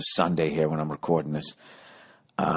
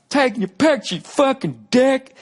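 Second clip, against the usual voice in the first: yelling heckler; 5 dB louder than usual. Retold or imitated. Heckler yelling at the comic from the crowd, high and loud. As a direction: bellow it like an offended drunk in the crowd. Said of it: "he just yells out".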